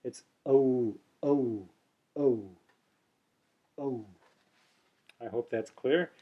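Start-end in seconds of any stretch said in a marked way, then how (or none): 0.5-4.1 soft oh with a longer oo end; Sanskrit au. Shown as a demonstration. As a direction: say oh, lengthening the oo part. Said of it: "the oo should be longer than the uh".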